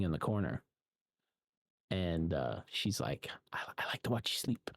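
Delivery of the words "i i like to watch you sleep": quick, creepy whisper. Retold or imitated. Nikki to Bear from the dark corner, creepy retold whisper. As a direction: whisper it like someone watching from a corner.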